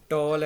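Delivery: टॉल said loudly in one beat; one unbroken syllable. Shown as a demonstration. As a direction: say टॉल in a single loud push, no pause. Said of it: "एक ही बार में हमने उनको बोल दिया".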